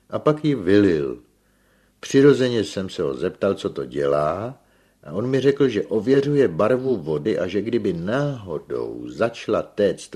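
lively villager reporting an oddity; Filoxen excitedly recollecting. A narrator's reading. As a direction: tell the café anecdote with gusto. Filoxen recounts the stranger's visit.

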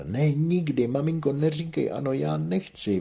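high, loud, pleading refusal; crying out in protest. A narrator's reading. Manon begs her mother not to sell, crying out. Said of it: "vykřikla Manon".

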